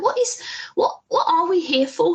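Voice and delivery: high-pitched, incredulous questioning; baffled. Retold or imitated. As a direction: act out utter bewilderment, pitch climbing. Acts out bafflement at Portmeirion.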